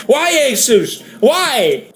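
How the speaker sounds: high, wailing plea; mock complaining. Forum people complaining to Asus, high mock-anguished wail. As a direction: cry out as a fed-up customer. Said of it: "They're all complaining".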